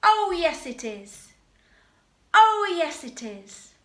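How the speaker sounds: loud, high cheeky "Oh, yes" then a drop; panto dame retort. Shown as a demonstration. Teacher models the cheeky dame's reply, loud and high. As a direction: fire back the dame's reply, cheeky and big. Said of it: "the dame".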